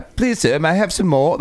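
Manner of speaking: high-pitched movie English accent. Shown as a demonstration. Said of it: "It sounds like movies to me".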